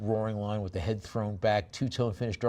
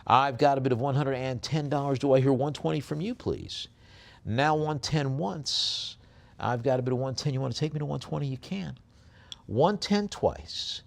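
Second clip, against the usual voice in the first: quick, lifted auction patter; brisk bid-calling with higher-pitched going calls; auctioneer's call; 4 semitones higher than usual. Acted. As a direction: press the room for a higher bid.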